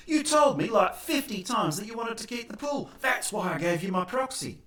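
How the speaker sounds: protest, hammed-up and aggrieved; indignant complaint. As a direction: owner berating Albo, mock indignant. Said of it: "confront Albo".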